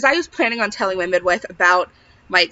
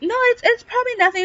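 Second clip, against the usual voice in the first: much higher, breezy brush-off; playing it off; 13 semitones higher than usual. To the tech about the blood, high breezy brush-off. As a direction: chirp it out like it's nothing. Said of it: "I was playing it off".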